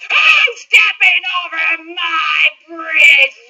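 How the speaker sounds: slow, loud booming troll; roaring troll. Acted. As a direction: roar it out slowly. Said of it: "roared the troll".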